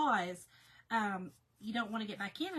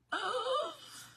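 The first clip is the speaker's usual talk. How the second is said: sharp, high gasp. Real, not acted. Vlogger confiding to viewers about her household, gasping high. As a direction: suck in a startled breath. Mock shock while confiding.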